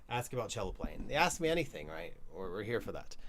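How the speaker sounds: ordinary talk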